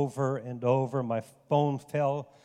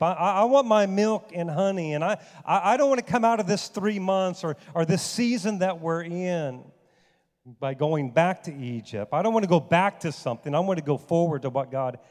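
passionate preaching; 3 semitones higher, 4 dB louder than usual. Acted. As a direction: preach it with fire. Preaching at full force.

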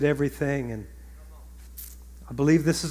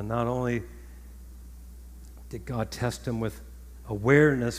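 ordinary talk, nothing marked

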